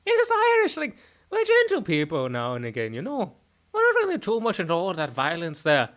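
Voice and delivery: soft Irish lilt; Irish accent. Shown as a demonstration. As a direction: talk on the soft end in Irish. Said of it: "a little bit more on the soft end".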